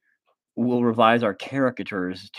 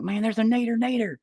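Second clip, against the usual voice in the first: high silly voice on nader nader; goofy high voice; 13 semitones higher than usual. Pretend. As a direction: sound dopey and urgent. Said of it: "I'll be like".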